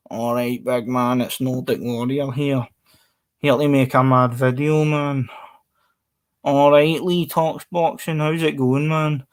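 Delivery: drawn-out imitation Glaswegian accent; Glasgow accent. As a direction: greet viewers in put-on Glaswegian. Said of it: "if I grew up in Glasgow".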